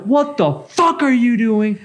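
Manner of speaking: high-pitched retold outrage; retold freak-out. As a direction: replay an incensed challenge, drawn out and high. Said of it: "I am flipping shit".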